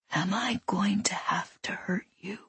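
very soft, menacing whispered question. A narrator's reading. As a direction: whisper it as a veiled threat. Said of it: "he whispered".

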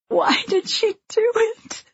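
high, slow tearful wail; anguished, high-pitched crying voice; sobbing. A narrator's reading. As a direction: plead through sobs. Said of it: "sobbing against him".

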